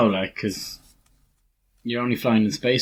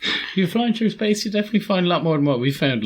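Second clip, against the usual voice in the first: excited; 10 semitones higher than usual. Real, not acted. Laughing mock explanation.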